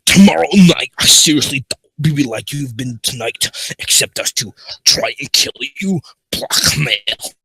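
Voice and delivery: loud pirate voice, opening high; pirate accent. Acted. Foxy confessing the blackmail to Alex, loud pirate accent. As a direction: push it out as an urgent pirate. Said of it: "In her pirate's accent".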